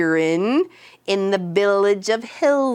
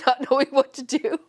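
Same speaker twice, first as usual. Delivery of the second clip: normal talking voice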